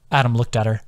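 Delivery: plain reading voice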